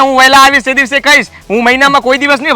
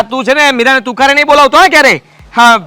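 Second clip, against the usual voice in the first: usual speaking voice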